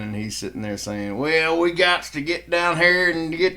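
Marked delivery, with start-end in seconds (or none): 1.2-3.6 higher, louder, slow mock Southern senator; hillbilly senator accent. Mocking a West Virginia senator on TV, in slow, hammed-up Southern accent. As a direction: ham up a backwoods Southern politician. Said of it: "some West Virginia senator on C N N".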